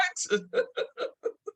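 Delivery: a very high, rising squeal of laughter. Real, not acted. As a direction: laugh in disbelief. Laughing at the kids' verdict.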